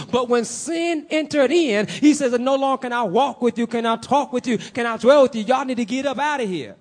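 high, emphatic pulpit delivery; driving sermon cadence, key words punched; forceful preaching. Real, not acted. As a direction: proclaim it with urgency. Preaches sin and redemption forcefully.

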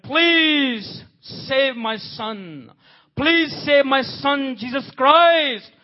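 high, drawn-out, desperate plea; God pleading. Acted. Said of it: "This is God's impression".

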